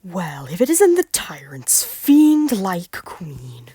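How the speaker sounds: slow, loud sneering taunt; Malcolm voice. Acted. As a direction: taunt slowly and loudly. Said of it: "Malcolm's voice is just my Centurion voice".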